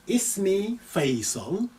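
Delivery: slow careful reading. Shown as a demonstration. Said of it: "I'll read this more slowly than usual for pronunciation".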